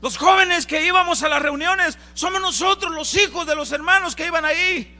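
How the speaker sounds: loud, high pleading cry; desperate plea. Acted. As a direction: beg the Lord at full voice, high and loud. Hijos suplicando al Señor. (súplica desesperada a gritos)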